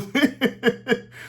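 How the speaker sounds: high short laugh; burst of laughter. Real, not acted. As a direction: laugh at your own bit. Laughing at own impression.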